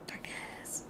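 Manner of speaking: breathy dramatic whisper; spooky whisper. Pretend. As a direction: whisper it like a dark secret. Dramatic echo of darkness.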